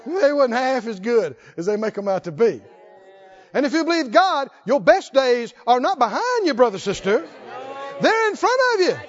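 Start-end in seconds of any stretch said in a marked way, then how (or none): 3.5-9.0 raised, rallying pulpit voice building to shouts; rally the congregation toward the promise; forceful preaching. Real, not acted.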